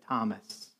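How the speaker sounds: very soft, low name call; loving, gentle. Retold or imitated. Jesus calling doubting disciple by name, gently. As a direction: call him tenderly, almost under the breath. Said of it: "in a loving, gentle way".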